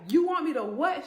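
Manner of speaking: loud, high-pitched indignant question; retold outburst of disbelief; incredulous. Woman to sister-in-law who wants her money, incredulous. Acted disbelief at sponsor demand.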